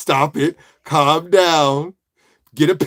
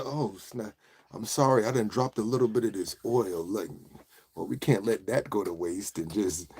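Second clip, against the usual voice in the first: soft, lowered smooth-guy voice; mock seductive; 5 semitones lower, 10 dB quieter than usual. Apron cook who dropped some oil, mock seductive. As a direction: play a sultry fake apology, low and soft.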